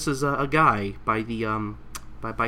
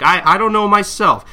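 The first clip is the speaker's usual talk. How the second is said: Kagura reply; 10 semitones higher, 11 dB louder than usual. Retold or imitated. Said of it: "And Kagura's like".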